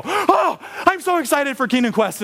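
put-on high-pitched burst of childlike excitement; squealing mock-kid eagerness; excited kid. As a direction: burst out like a thrilled little kid. Acting excited kids dismissed to class.

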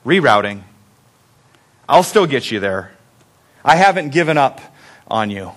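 low, tender reassurance with long pauses; calm, affirming. Acted. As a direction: let each promise settle gently. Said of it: "but affirming voice".

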